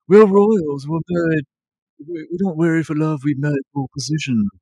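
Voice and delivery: mock her cool royal talk; the princess explaining royal marriage to the king, in her odd voice; lightly voiced princess. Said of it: "her voice is very funny".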